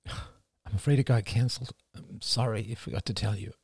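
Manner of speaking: father apologising softly to crying son; soft apology. Acted. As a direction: trail off in regret.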